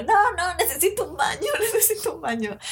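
high, giggly whine for a bathroom; laughing, high-pitched plea; desperate pleading. Retold or imitated. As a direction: beg like you're about to burst, laughing. Drunk self pleading for bathroom.

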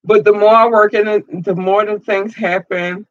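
welling up with emotion. Real, not acted. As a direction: push it out before the tears come. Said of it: "it's just an emotional process".

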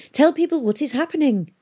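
high, insistent plea; urging. A narrator's reading. Catherine pushes Pomeroy to speak out, urgently. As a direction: press him to take action. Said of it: "urged Catherine".

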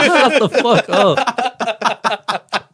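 high-pitched, laughing mock outburst; laughing retort. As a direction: crack up while playing exasperated. Acted comeback amid laughter.

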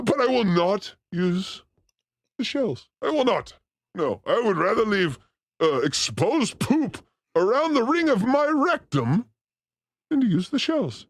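ordinary talk

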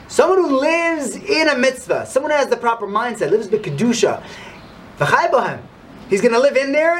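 high, impassioned sermon voice; rousing preacher; fervent preaching. Acted. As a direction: drive the lesson home to the congregation. Rabbi preaches the lesson.

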